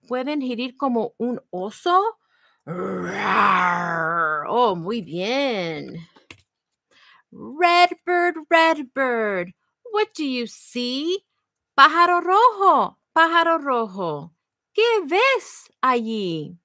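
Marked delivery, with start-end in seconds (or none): 2.6-4.4 low growling rawr like a bear. Shown as a demonstration. Librarian showing the kids a bear's growl, low and gruff. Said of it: "Can you growl like a bear?". 7.3-16.6 bright, lilting read-aloud for little ones; sing-song the refrain to the kids; reading to children. Acted. Librarian reading the refrain to children, sing-song.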